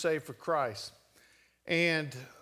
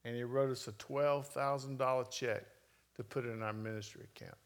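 normal talking voice

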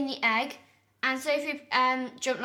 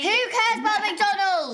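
indignant shout; 7 semitones higher, 6 dB louder than usual. Real, not acted. Indignant retort to sister.

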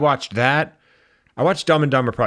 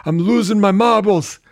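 silly character voice; 11 semitones higher, 5 dB louder than usual. Pretend. Silly put-on aging star voice.

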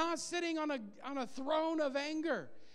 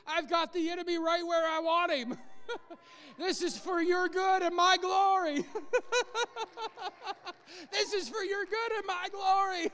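louder, higher, laughing triumphant God; 5 semitones higher, 4 dB louder than usual. Acted. Preacher voices God mocking the enemy, loud gleeful laughing. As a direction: boom it out laughing with glee. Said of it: "And God's saying".

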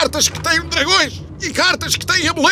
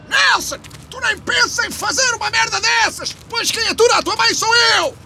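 shouting; 7 semitones higher than usual. Acted. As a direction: yell up at the roof. Father yelling to roof. (gritar para o telhado)

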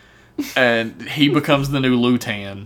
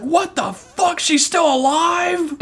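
imitating a stunned villain, pitched way up; hammed-up disbelief, high and slower; mock shocked outburst; slower than usual. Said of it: "He's like".

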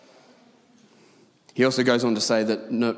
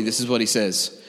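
ordinary talk, nothing marked

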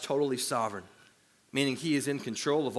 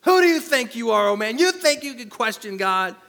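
much higher and louder hellfire yell; old Southern preacher; 8 semitones higher, 9 dB louder than usual. Acted. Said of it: "yelling like an old Southern preacher".

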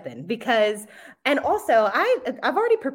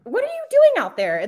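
loud, high-pitched mock outrage; voicing mad dog; 14 semitones higher, 7 dB louder than usual. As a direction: scold the passerby as an indignant dog. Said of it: "He's so mad at him always".

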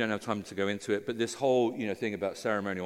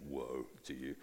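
stern woe; 11 semitones lower, 14 dB quieter than usual. Pretend. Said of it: "you don't want Jesus to say".

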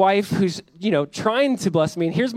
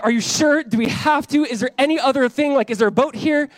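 higher-pitched imitation of nervous pleading; mock plea for any way out; retold anxious pleading; 6 semitones higher, 3 dB louder than usual. Terrified husband asks his wife for another way, anxious pleading.

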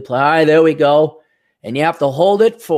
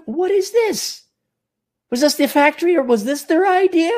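baffled; 12 semitones higher than usual. Retold or imitated. Baffled at found plastic.

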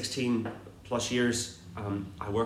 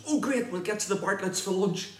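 higher, eager voice imitating a friend; eager friend quote; 9 semitones higher than usual. Pastor imitating Kevin's reply about lunch, higher and eager. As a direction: play up his delight at the lunch invite. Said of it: "he goes".

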